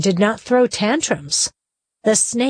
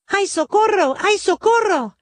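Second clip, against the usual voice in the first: high panicked cries for help; crying for help; 11 semitones higher than usual. Acted. Campesino fleeing the hungry snake, crying for help. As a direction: yelp for help while running away.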